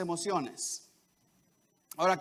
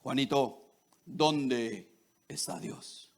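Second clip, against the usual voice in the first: lightly done deep preacher voice, trailing into a whisper; a solemn pastor fading off; 10 semitones lower, 5 dB quieter, much slower than usual. Retold or imitated. Pastor asking the boy a third time, solemn and fading. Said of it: "le dice el pastor".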